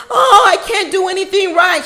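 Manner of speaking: high, put-on wail of self-pity; mock self-deprecation. Commentator mocking the cornered girlfriend's turn to self-pity, high self-deprecating whine. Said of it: "this sort of self-deprecating personality".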